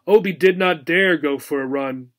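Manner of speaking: ordinary delivery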